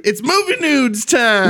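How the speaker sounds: high, drawn-out segment shout; hammed-up announcer. Host launches movie news, big drawn-out announcement. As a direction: stretch it out like a show intro.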